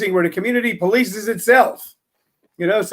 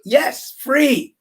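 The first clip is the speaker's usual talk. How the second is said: higher, bright confirmation; playful pretend answer; 6 semitones higher than usual. Host answers the pretend listener, mock excited. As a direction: answer your imaginary listener gleefully. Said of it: "I was acting like I was talking to someone else".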